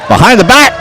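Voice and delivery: high shouted burst; thrilled play-by-play shout; excited call. Real, not acted. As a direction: yell it as the shot drops. Behind-the-back score call.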